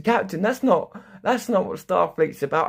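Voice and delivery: high, loud prim objecting computer; a hint of a shocked computer protesting; mock starship computer scolding. AI objecting, voiced higher.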